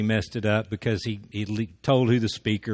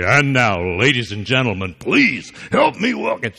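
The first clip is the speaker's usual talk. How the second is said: wrestling announcer; 2 semitones higher, 6 dB louder than usual. Shown as a demonstration. Speaker mock-introduces a guest, as a wrestling announcer. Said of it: "World Wrestling Federation".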